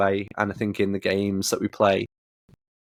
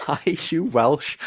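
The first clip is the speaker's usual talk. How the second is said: high-pitched, exasperated question through amusement; mock exasperation; 7 semitones higher than usual. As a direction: ask it in baffled, higher-pitched protest. Mock complaint at character's accent.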